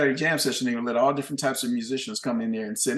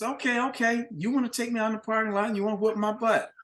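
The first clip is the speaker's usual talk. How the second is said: much higher, quick mocking repeat; mocking taunt; 8 semitones higher than usual. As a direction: throw the threat back, laughing at him. Bandleader mocking the threat.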